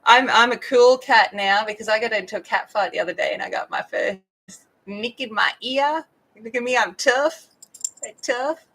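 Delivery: put-on a young tomcat boasting about his first fight; voicing the cat. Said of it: "And you go".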